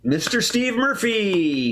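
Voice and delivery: grand announcer finish. Acted. Host builds to final name.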